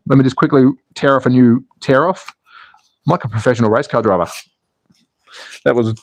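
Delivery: normal talking voice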